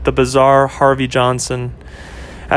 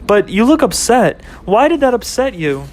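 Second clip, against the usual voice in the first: put-on higher, concerned girlfriend voice; girlfriend Ashley; 8 semitones higher than usual. Girlfriend noticing he is upset, higher and concerned. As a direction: ask gently but pitched up.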